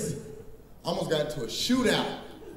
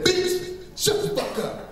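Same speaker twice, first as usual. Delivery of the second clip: mock angry snap; 12 semitones higher, slower than usual. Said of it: "I was mad as hell".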